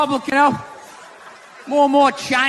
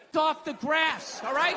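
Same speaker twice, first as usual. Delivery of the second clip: usual speaking voice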